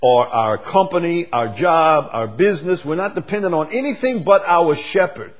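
high, loud building preaching; preaching at full force. Real, not acted. Preacher declaring dependence on the shepherd, high and loud. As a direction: build the fire up to the end.

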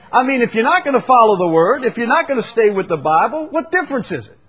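loud, fast, high preaching; fast pulpit shout; fiery preaching. Acted. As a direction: drive it home to the congregation. Preaching at full force.

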